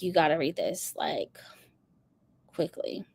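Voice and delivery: soft whispered urging. Retold or imitated. Retelling urging her sister to read it, whispered. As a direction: hush it like a secret tip.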